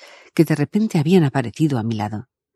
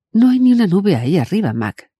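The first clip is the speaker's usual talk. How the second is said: loud retort; 7 semitones higher, 5 dB louder than usual. A narrator's reading. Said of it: "dijo Lord".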